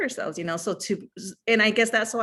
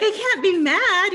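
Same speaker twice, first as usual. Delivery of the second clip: higher, louder, slow and emphatic; emphatic exclamation; 12 semitones higher, 7 dB louder, slower than usual. Retold or imitated. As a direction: insist you can't be mad. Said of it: "I was just like".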